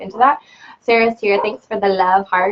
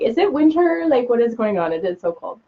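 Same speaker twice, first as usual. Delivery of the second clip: higher-pitched mock bewilderment, then dropping off; mock disbelief; 3 semitones higher than usual. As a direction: play up being baffled, starting high and sinking. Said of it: "I'm like".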